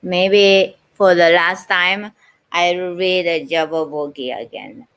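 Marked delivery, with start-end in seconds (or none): none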